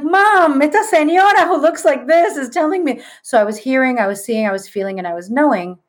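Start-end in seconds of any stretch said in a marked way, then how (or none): 0.0-3.0 high, urgent calling out; rushing to tell mom what she sees; alarmed call to mom. Retold or imitated. Teen calling to her mother mid-seance, high and alarmed. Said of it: "And I'm like".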